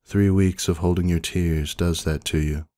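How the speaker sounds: ordinary delivery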